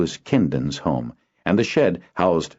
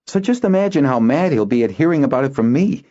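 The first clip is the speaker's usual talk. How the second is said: high, louder and fast; boy Kinden; 10 semitones higher, 4 dB louder, faster than usual. Acted. Boy arguing why he can't tell Natalon, fast. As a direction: make the case quickly.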